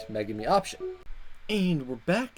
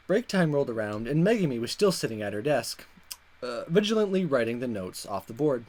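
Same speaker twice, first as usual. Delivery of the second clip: ordinary talk, nothing marked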